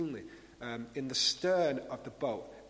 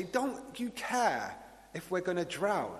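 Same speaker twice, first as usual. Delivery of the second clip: higher, drawn-out mock-panicked plea; retold panic; 4 semitones higher, slower than usual. Disciples waking Jesus in the squall, retold with mild panic. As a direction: lightly act out an alarmed, reproachful question. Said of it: "they panic and they wake him up".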